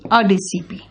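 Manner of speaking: अ before DCP, a short uh; article demo. Shown as a demonstration. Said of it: "ए का pronunciation है बेटा".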